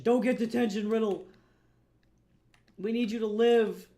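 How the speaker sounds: loud, playful plea; mock urgent shout. Streamer warns a viewer facing detention, mock urgent shout. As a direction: call out in pretend alarm. Calling out to a departing chatter.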